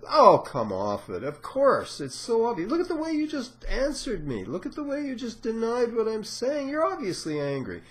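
patient accuses the therapist of hidden anger, loud and high-pitched; insistent accusing patient. Retold or imitated. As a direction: play a patient who insists you're angry, voice climbing high. Patient accuses therapist, acted quarrel.

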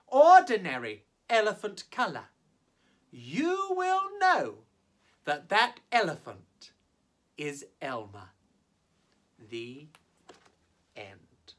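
plain reading voice

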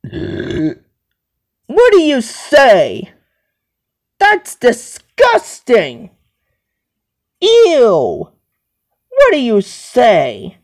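slow, drawn-out disgusted reprimand; disgusted scolding. Acted. Reacting to someone's burp, disgusted scolding. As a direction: scold with exaggerated disgust, pitch swooping down.

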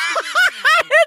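real laugh that jumps up high and loud; high burst of laughter. Real, not acted. Cohost cracks up at the French joke, squealing laugh.

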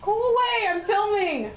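high-pitched, irritated call to someone intruding; annoyed yell. Real, not acted. Yelling at interrupting brother.

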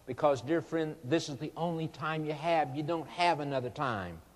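normal talking voice

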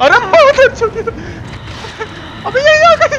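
very high, loud squealing yelp; shrieking in fright. Real, not acted. Gamer ambushed by the witch, high frightened shriek. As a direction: shriek like you've been ambushed. Witch suddenly attacks player.

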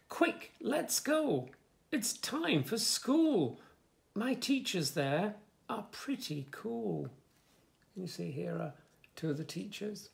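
lively, sing-song story reading; reading to children. Acted. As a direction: bounce the rhymes for little listeners. Reads rhyming picture book aloud.

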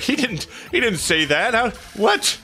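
high-pitched incredulous quote; retold disbelief. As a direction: act out your younger self's astonishment. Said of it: "I was like".

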